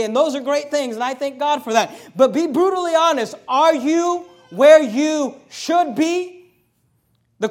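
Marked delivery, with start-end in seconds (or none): 2.2-6.5 pressing question. Acted.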